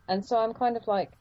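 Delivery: normal talking voice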